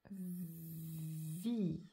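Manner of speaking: VIE with a long held v; drawn-out word. Shown as a demonstration. Teacher writing vie without stopping, drawn out. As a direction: stretch the v of vie, then the vowel.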